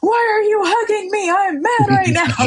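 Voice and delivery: high, crying shout of anger; half-sob, half-yell, pushing comfort away; mad outburst. Retold or imitated. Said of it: "but I was mad".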